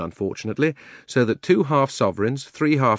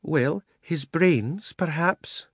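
slow, high, gentle elephant; mild reply; 7 semitones higher, much slower than usual. A narrator's reading. She-elephant gently correcting the bulldog, slow and mild. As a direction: answer mildly, drawing it out. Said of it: "she replied mildly".

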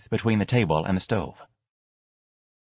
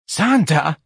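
loud scandalized cry; shocked gasp; 8 semitones higher, 5 dB louder than usual. Acted. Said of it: "Missus Riley gasped, shocked".

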